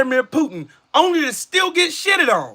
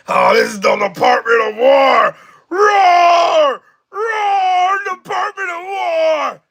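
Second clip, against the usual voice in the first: long, high, drawn-out pretend roars; mock-macho roaring; 6 semitones higher, 4 dB louder, slower than usual. Ridiculing the Department of War name, hammed-up roaring. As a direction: bellow it like a cartoon tough guy.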